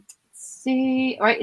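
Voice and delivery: usual speaking voice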